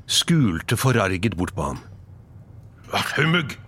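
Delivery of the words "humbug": abrupt, higher-pitched scornful bark. Acted. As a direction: bark it out dismissively. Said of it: "bjeffet han igjen". (kort, gretten bjeffing)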